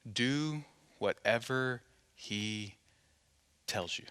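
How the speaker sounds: slow, low and very soft; quiet, deliberate instruction, dropping away at the end; hushed counsel. Acted. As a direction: let each word settle, with long pauses. Mary's words as solemn counsel.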